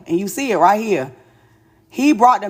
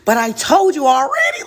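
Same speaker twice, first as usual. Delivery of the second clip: ordinary talk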